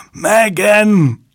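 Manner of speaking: high, loud, desperate shout; desperate scream. A narrator's reading. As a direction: scream her name after her. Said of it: "schrie David".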